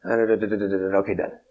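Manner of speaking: bored mock skim of the names, then "okay, done"; a quick, dismissive skip through the genealogy; dismissive skimming. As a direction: rattle off "da, da, da" like skipping a boring list. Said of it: "We tend to go".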